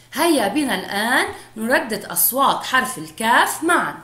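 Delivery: ordinary talk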